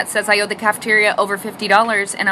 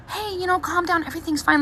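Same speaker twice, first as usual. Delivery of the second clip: mock soothing staff; 6 semitones higher, 6 dB quieter than usual. Hospital staff calming panicked mom.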